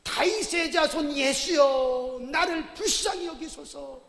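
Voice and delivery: slow, loud desperate plea, drawn out; crying out loud. Acted. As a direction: cry out to a passing savior, stretching each word. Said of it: "뭐라고 소리를 지르냐면".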